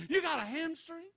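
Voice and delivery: high, loud disbelieving bro voice; mock envious shout. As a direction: yell in mock envy. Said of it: "I said".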